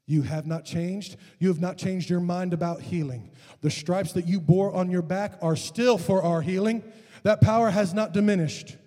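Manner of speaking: swelling preacher's prayer; declaratory prayer. Real, not acted. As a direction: declare it to God with growing force.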